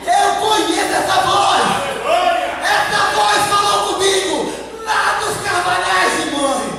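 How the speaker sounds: loud, fervent shouted testimony; full-force preaching. Real, not acted. Preacher voicing patriarchs who know the voice, shouting. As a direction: cry out each prophet's recognition to the church.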